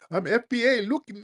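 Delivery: very high-pitched mimicry; mock them in a squeaky high voice; exaggerated high imitation; mocking impression of a guest. Mimics guest hiding under a do-rag.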